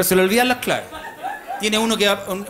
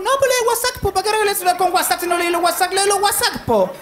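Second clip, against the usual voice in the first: mother's voice; 10 semitones higher than usual. Retold or imitated. Son imitates his mother on the phone in a high insistent voice. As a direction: play the stubborn mother, pitched way up.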